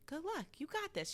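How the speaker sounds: repeated encouragement. Retold or imitated. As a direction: lift the pitch to repeat it for a puzzled pupil. Repeats it to puzzled student.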